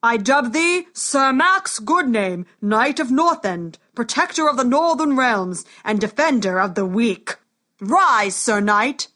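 loud, slow knighting ceremony; booming warrior king. Acted. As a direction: bellow the dubbing proudly. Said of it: "bellowed the king".

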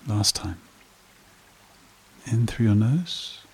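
slow meditation voice with long pauses; guided meditation. Acted. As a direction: guide listeners gently into calm, phrase by unhurried phrase.

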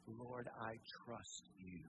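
slow, hushed, tender repetition; slow tender prayer. Pretend. Pastor repeating the believer's prayer, slow and tender. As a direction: linger on each word, surrendering.

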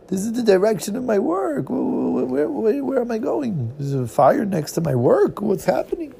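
high, frantic panic; rising panic as he realizes; panicked. Acted. Character panics near his work.

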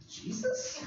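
breathy high whisper of disbelief. Retold or imitated. Disciples spotting a figure on the sea, whispering in disbelief. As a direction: squint into the storm and whisper his name.

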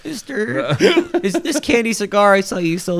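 put-on high, laughing kid voice; mock little kid. Undercover kid asking the clerk, high mock kid voice. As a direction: play a sneaky little kid.

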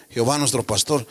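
ordinary talk, nothing marked

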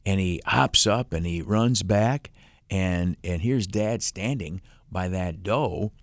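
usual speaking voice

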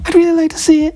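very high, squeaky client imitation; mock customer voice. Tattooist redoes his client's request, very high mock voice. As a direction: redo the client in a tiny high falsetto. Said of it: "they don't sound like Kermit the Frog, though".

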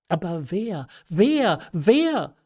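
rising urgency on each repeat; urgent questioning. A narrator's reading. Marquise urgently demands the culprit's name.

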